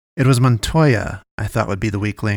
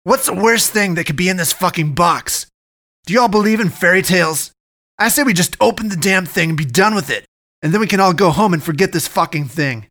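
higher-pitched frustrated ranting; shouting; 8 semitones higher than usual. A narrator's reading. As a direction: rail at the room as he paces.

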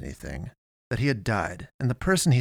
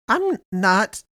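high, louder objection, broken off; indignant protest; 11 semitones higher, 6 dB louder than usual. A narrator's reading. Eddie denying he is afraid, protesting. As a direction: start to protest, voice jumping up.

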